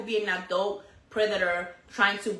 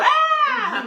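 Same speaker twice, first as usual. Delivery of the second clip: high, loud, laughing scream; explosive mock scream; 9 dB louder than usual. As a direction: burst out like an explosion. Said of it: "I will explode".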